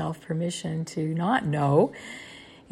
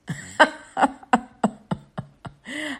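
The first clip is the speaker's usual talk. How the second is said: loud, high burst of laughter; amused laugh; 8 semitones higher, 6 dB louder than usual. Real, not acted. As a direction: laugh heartily at your own joke. Amused by own divine voice.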